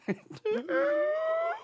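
high squeaking door creak; creaky door sound. Shown as a demonstration. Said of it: "Here's the sound of you coming in late".

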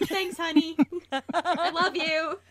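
slow, sweet, dutiful thanks; placating. Retold or imitated. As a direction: humour him with a drawn-out thanks. Placating boyfriend's Scooby-Doo texts.

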